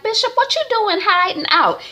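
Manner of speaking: flirty woman coaxing the bishop, high and coy. Retold or imitated. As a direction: sweet-talk the bishop.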